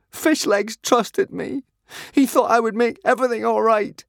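grieving, holding back sobs; crying. A narrator's reading. Hiccup keeps crying about Fishlegs, tearful. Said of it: "Hiccup began to cry".

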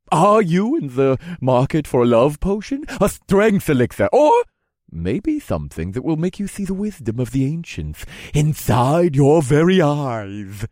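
high, loud salesman pitch; eager merchant voice. Acted. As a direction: sell it like a huckster. Vendor character voice.

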